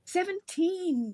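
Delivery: high, slow delighted cry; a landlady's rapt, drawn-out cry; cried out. A narrator's reading. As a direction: exclaim it with sudden delight. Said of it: "she cried".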